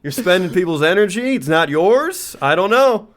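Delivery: high, mock politician skepticism; Trump. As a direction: cast doubt like a rally speaker. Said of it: "you could just be like".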